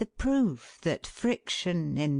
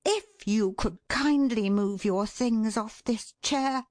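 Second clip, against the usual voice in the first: cold fury; 6 semitones higher than usual. A narrator's reading. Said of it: "in a voice that would blow brains out".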